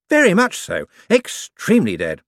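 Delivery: high, eager, bright-voiced wizard; a jumpy official covering for himself. A narrator's reading. Hicks confirming the professor's death.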